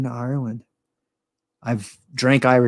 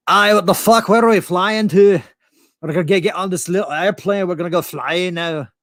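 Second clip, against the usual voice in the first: higher, loud hammed-up Scottish brogue; mock Scot on a plane, big Scottish accent; 7 semitones higher, 3 dB louder than usual. Comedian fakes being Scottish to seatmate, hammy Scottish brogue. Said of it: "I could do a pretty good Scottish brogue".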